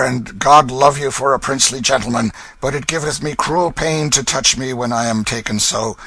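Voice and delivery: milk the fake agony; hammed-up beggar's whine. Hugo fakes a fit.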